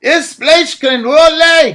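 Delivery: high, loud, drawn-out protest. A narrator's reading. Absil protesting at the line, loud and slow. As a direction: push back hard. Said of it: "he protested".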